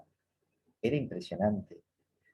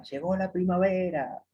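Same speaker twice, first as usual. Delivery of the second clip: imitated little girl's shout, pitched way up; Mafalda's joyful cry; 9 semitones higher than usual. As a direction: call it out in a raised, high voice. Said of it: "Y Mafalda le grita".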